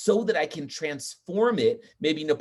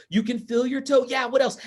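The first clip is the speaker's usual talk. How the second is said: high, loud eager voice; excited audience call; 9 semitones higher, 5 dB louder than usual. Retold or imitated. Audience answer and cheer.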